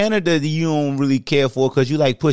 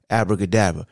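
lower, deep-voice abracadabra; 6 semitones lower than usual. Shown as a demonstration. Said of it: "The deep voice like this".

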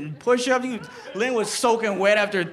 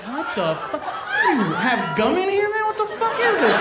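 acted bewilderment climbing higher; baffled sergeant; 8 semitones higher than usual. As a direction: play the puzzled sergeant, pitch jumping up. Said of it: "he just starts like".

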